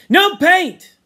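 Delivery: high, forceful shout. A narrator's reading. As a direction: shout it to overrule them. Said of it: "Ralph shouted".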